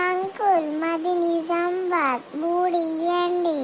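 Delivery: slow, hesitant ticket request; too slow and faint. Acted. Girl asks the conductor for tickets, very slowly. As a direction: ask for tickets dragging the words. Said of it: "ఏంటమ్మా గట్టిగా మాట్లాడు".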